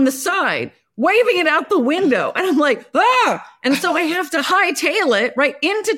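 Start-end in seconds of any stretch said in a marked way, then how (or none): 2.9-3.5 sudden high yelp; startled yelp. Retold or imitated. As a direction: yelp in alarm. Panicked cry seeing courier in traffic.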